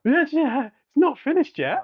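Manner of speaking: high, loud and eager; excited. Real, not acted. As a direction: tease the next bit eagerly. Excited to continue story.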